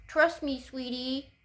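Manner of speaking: squeaky mock imitation pitched high; mocking Tom Hardy. Vlogger mocking the actor's voice in a recent film, pitched high and teasing. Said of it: "he sounds like he's speaking really high".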